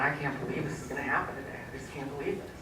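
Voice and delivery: barely voiced, fast disbelieving murmur to herself; stunned murmur. Mother watching her daughter before surgery, murmuring in disbelief. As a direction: hush it out under your breath, quick and numb. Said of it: "I'm looking at her going".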